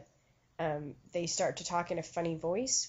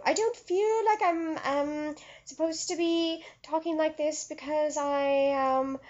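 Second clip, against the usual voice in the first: put-on spirit-medium voice, pitched way up and projected; funny channeling voice; 10 semitones higher, 7 dB louder than usual. Shown as a demonstration. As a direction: parody a medium channeling a being from another dimension. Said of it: "talk in that sort of voice suddenly".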